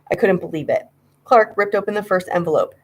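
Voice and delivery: plain reading voice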